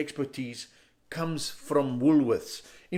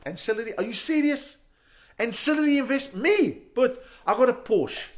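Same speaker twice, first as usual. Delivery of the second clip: higher, louder, incredulous comeback; indignant disbelief; 7 semitones higher, 4 dB louder than usual. Acted. Customer answering the bank manager, indignantly incredulous. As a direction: protest as if the question insults you.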